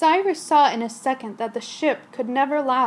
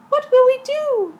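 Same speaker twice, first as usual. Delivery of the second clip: much higher, louder worried passenger; frightened cry; 12 semitones higher, 6 dB louder than usual. Acted. Passenger crying out to the captain in fear. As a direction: cry out in alarm. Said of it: "someone cried".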